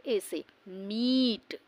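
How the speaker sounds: मीट with the ee drawn out long and slow; long vowel demo. Shown as a demonstration. Teacher drawing out मीट for students, slow and long. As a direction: stretch the ee in मीट for the class. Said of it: "ये थोड़ा सा long pronounce होगा".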